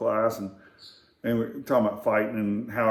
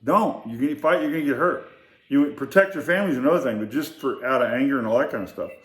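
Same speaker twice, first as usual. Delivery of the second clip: lecturing quote; 3 semitones higher than usual. Retold or imitated. Instructor warns the teen off fighting, raised earnest voice. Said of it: "this guy starts trying to tell me".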